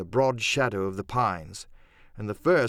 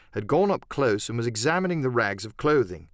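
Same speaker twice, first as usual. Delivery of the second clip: usual speaking voice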